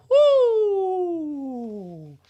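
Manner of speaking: high drawn-out ghostly woo; haunt the listeners; spooky woo. Acted. Narrator making a ghostly sound to end the scene.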